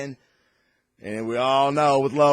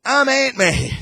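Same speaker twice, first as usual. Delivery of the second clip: much higher, odd put-on kid voice; weird kid; 12 semitones higher, 8 dB louder than usual. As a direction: play the strange kid proudly picking Ant-Man. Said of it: "that other weird kid that goes".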